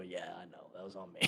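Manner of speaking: low, soft retold admission; quiet. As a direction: own up sheepishly under your breath. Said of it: "It's quiet".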